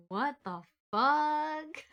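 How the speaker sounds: slow, drawn-out what the fuck; stunned character. Retold or imitated. Narrating the boy's slow baffled reaction. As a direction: drag it out in disbelief. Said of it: "He's like".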